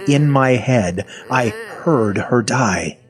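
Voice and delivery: usual speaking voice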